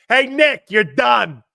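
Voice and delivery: high, loud jeer hurled at the coach; heckling fan shout. Retold or imitated. Imitating Philly fans heckling Nick Sirianni, loud jeering shout. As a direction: yell it like an angry fan in the stands.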